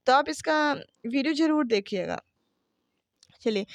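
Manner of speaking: normal talking voice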